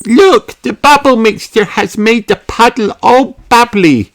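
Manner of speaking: put-on high, loud little girl voice; excited piggy girl pointing; delighted Peppa call; Peppa crying out. A narrator's reading. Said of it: "cries Peppa".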